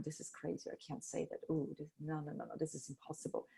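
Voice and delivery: whispered self-doubt, very soft; whispering to herself in disbelief. Retold or imitated. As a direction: hush it like a nervous thought. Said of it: "I was doubting it all the time".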